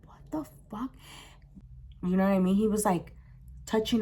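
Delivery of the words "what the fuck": very soft, voiceless whisper; in-her-head whisper. Retold or imitated. Teller's silent thought on seeing it, whispered. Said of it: "In my head, I'm like".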